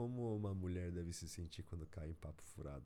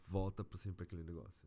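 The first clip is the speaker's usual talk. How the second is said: ordinary talk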